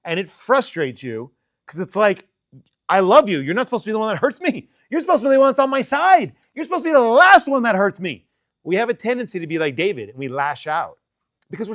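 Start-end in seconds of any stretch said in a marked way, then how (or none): 2.9-8.3 higher and louder toward the end, wounded protest; talking back to someone you love who hurt you; building from a plea to a pained, louder outcry. Retold or imitated. Voicing hurt person's inner complaint.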